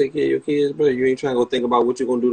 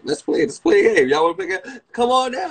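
hammed-up host, higher and louder; game show host; 9 semitones higher, 3 dB louder than usual. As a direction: call them down like a TV host. Game show bit, contestant named.

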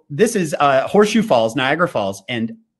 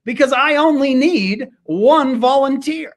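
high, slow teasing challenge; spring the trap on the crowd; high, slow showman's dare; 11 semitones higher, slower than usual. Retold or imitated.

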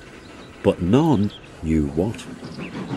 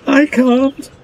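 very high, loud protesting squeak; Percy, squeaking; 9 dB louder than usual. A narrator's reading. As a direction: squeak your refusal. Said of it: "squeaked Percy".

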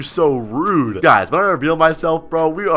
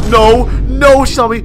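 high, loud alarm; panicked; 7 semitones higher, 7 dB louder, slower than usual. Real, not acted. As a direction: cry out at being caught. Gets spotted.

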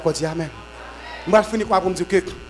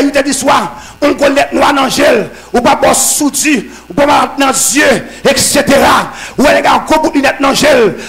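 loud, high, shouted sermon that keeps building to amens; fiery preaching; 7 semitones higher, 8 dB louder than usual. Acted. Preacher rousing the congregation, shouting loud and high. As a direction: preach it at full force to the congregation.

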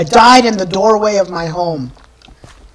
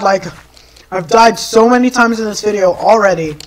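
normal talking voice

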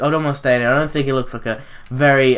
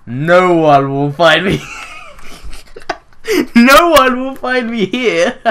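slow, louder and higher, with an evil cackle; gloating villain; 7 semitones higher, 6 dB louder, slower than usual. Pretend. Gamer hiding from the seeker, slow villain gloat. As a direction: gloat slowly like a cartoon villain.

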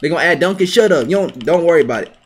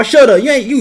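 ordinary talk

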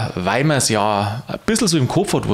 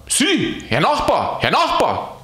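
higher calling after the saint; 11 semitones higher than usual. Acted. Alois hails the floating saint, calling higher. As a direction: call out to a passing neighbour. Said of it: "schrie Aloysius".

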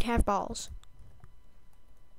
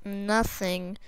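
ordinary delivery

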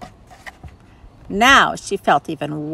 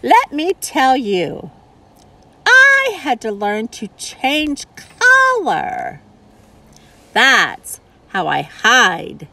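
chameleon voice; 5 semitones higher than usual. Acted.

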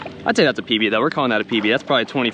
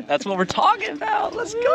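laughing, high-pitched cheer; excited. Real, not acted. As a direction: whoop it up with a laugh. Celebrating the released trout.

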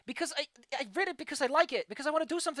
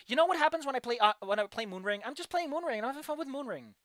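ordinary talk

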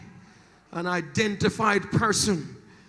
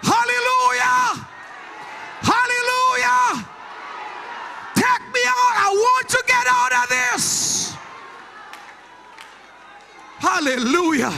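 shouted, rhythmic call and response over music; preaching at full force; 12 semitones higher than usual. Acted. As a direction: preach it hard with the organ. Preacher leads shouted prayer.

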